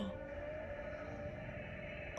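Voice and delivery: long, soft gasp; hushed intake of breath; awed gasp. Real, not acted. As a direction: suck in breath in amazement. Gasping at the trailer.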